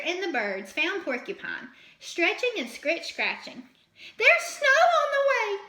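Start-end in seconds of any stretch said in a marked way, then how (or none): 4.2-5.6 high, loud, urgent fox voice; breathless warning in a high voice; panting Fletcher. A narrator's reading. Fletcher warns Porcupine, high and urgent. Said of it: "panted Fletcher".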